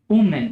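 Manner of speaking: উমেন said as WU-men; correct pronunciation. Shown as a demonstration. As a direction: model uমেন cleanly. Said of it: "এই শব্দটির singular ফর্মের উচ্চারণটি হচ্ছে".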